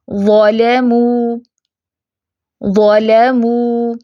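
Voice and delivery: ظلموا very slowly, thick ظ and light لام; heavy zaa. Shown as a demonstration. Teacher recites the example word slowly. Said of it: "ابھی دیکھیں وا فیٹ ہے".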